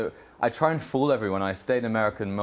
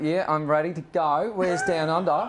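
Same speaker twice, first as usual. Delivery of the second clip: lively put-on Australian accent, pitched up; 4 semitones higher than usual. Shown as a demonstration. Actor shows interviewer his switch to Australian accent. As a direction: switch into playful Aussie talk. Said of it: "Could you switch to Australian accent?".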